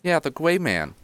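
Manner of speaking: put-on high small-boy voice; childlike, pitched well up; youngest child. Little boy confirming the gray man to a visitor, childlike. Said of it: "the youngest one".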